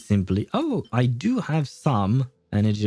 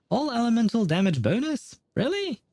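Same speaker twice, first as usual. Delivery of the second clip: much higher, questioning rise; real disbelief; 11 semitones higher than usual. Real, not acted. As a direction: question it in high-pitched surprise. Doubts what was just read.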